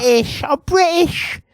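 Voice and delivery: very high shouted British; shrieked British. Shown as a demonstration. Co-host parodying the accent, squealing British very high. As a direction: squeal British up at the top of your voice. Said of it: "like that".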